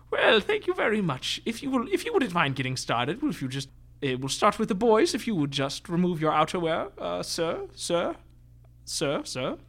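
high, fussy little shopkeeper; squat little tailor voice. Acted. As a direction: bustle politely like a tiny tailor. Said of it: "He says".

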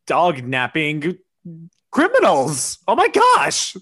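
high, loud, distressed exclamations; shocked outcry. Acted. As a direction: gasp out your horror at full pitch. Shocked reaction to dog thieves.

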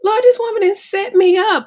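high, loud disbelieving appeal to the Lord; incredulous aside. Retold or imitated. To God about the trainer, a high incredulous aside. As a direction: throw it up to heaven in mock disbelief.

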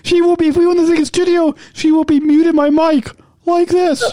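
playful put-on high host voice. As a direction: keep the silly high pitch going. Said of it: "Can you go back to your normal voice".

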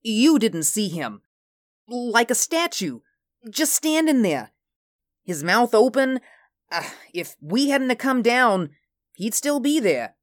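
high, agitated crewman; pitched well up, urgently recounting a fright; fearful. A narrator's reading. Jones telling Peterson about the frozen captain, fearful. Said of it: "his eyes gray with fear".

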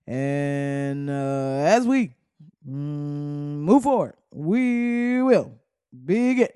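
drawn-out, halting Obama cadence with long held syllables; Barack Obama. Retold or imitated. Host mimicking Barack using all of the words, exaggerated halting delivery. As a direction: stretch the filler words, then lift into each phrase. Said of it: "Barack is using all of the words".

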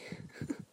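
soft, breathy chuckle; hushed laugh on the breath; silent chuckle. A narrator's reading. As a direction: chuckle almost without sound. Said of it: "chuckles silently".

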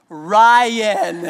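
high, loud mock little-girl "Ryan"; loud toddler call. Pastor imitating his toddler loudly calling him by name. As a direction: belt "Ryan" like a toddler who knows it works. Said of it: "she kinda mouths it out real loud".

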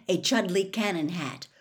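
ordinary delivery

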